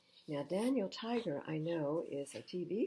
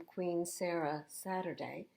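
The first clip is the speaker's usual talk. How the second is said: ordinary delivery, nothing marked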